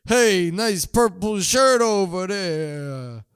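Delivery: slow, drawn-out call across a crowd; playful slow shout across the hall; mock yell. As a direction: holler it at someone in the stands. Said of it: "lines prepared to yell at specific people".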